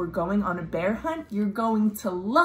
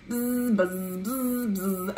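bee buzz; slower than usual. Acted. Imitating bees.

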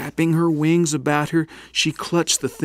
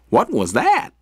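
high, louder startled chick cry; a baby bird jumps at the noise; baby bird startled by something falling into the nest; 10 semitones higher, 4 dB louder than usual. Acted. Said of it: "cried Flap".

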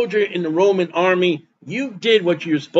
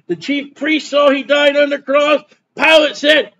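high, insistent preacher's litany; emphatic preaching; 6 semitones higher than usual. Real, not acted. Preacher lists witnesses to the death, emphatic and insistent. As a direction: drive each repeated phrase home on a raised pitch. Preacher builds a repeated list.

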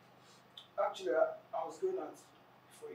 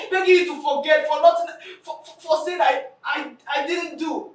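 shouting her grievance; loud, hurt scolding; angry shout; 6 dB louder than usual. Acted. Wronged woman shouting at the friend begging forgiveness.

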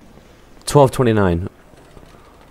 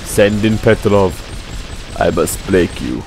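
lightly put-on Russian heavy; mock Russian accent; 5 dB quieter than usual. Gamer quoting film-style Russian villain lines, mock Russian accent.

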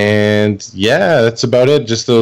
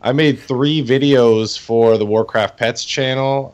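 ordinary talk

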